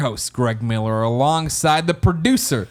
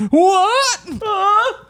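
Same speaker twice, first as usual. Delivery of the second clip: high, loud laughing what; laughing surprise; 5 dB louder than usual. Real, not acted. Sudden laughing exclamation.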